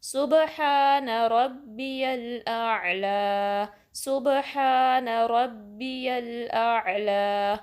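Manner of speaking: slow, drawn-out chanted recitation; measured tajweed recitation; slow Quranic recitation. Shown as a demonstration. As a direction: recite it carefully, stretching the long vowels.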